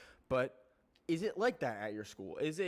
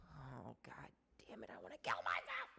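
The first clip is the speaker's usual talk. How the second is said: whispered, much softer mock despair; mock gloomy whisper; 3 semitones lower, 9 dB quieter than usual. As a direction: breathe it out like a melodramatic teen. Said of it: "be like".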